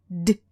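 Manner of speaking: a short clipped D stopping dead; sound D. Shown as a demonstration. Teacher demonstrating a stopped D sound. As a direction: say D and let it stop at once. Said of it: "I'm going to get the sound".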